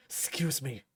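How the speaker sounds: low gruff excuse; gruff mutter. Acted. Ashamed Bruce storms out.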